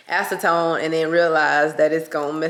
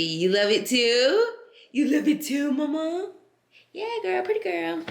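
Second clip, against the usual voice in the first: high, sing-song pet voice, pitched up; 11 semitones higher than usual. Real, not acted. Talking to her dog Sky Baby.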